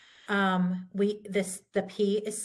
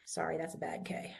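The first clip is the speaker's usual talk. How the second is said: normal talking voice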